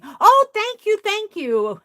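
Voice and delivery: ordinary talk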